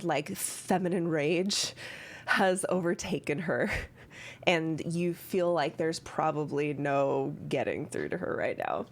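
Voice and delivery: normal talking voice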